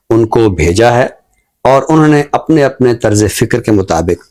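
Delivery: normal talking voice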